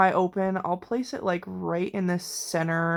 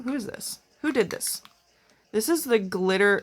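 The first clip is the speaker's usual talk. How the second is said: normal talking voice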